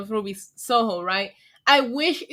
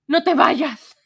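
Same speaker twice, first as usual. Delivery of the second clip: dramatic soap-opera plea, louder; telenovela acting; 2 semitones higher, 8 dB louder than usual. Streamer hamming a telenovela plea to a donor, louder. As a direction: ham it up like a telenovela heroine. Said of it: "How do you guys like my telenovela acting?".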